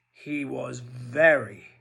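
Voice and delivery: usual speaking voice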